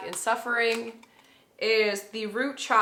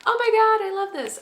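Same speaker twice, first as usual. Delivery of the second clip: much higher, gushing over the cards; thrilled; 13 semitones higher, 6 dB louder than usual. Real, not acted. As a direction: squeal with real joy.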